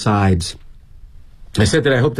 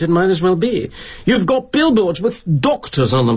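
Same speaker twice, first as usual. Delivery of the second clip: higher-pitched, outraged British junior's tirade; indignant sputtering; 6 semitones higher than usual. Acted. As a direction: sputter accusations at the boss, pitched up. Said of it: "he sputters like a boiling tuber".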